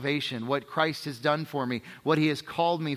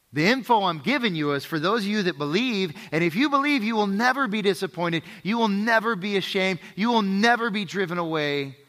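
raised, higher-pitched, insistent promise; emphatic preaching; 5 semitones higher, 4 dB louder than usual. Real, not acted. Preacher assuring those of you that believe, emphatically. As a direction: drive each promise home with rising force.

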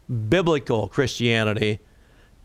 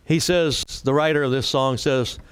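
normal talking voice

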